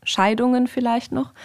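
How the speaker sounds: ordinary talk, nothing marked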